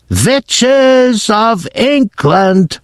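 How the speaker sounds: commanding screech that stretches every word; Grand High Witch shouting. Acted. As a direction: roll it out slowly like a tyrant addressing her army. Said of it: "she yelled".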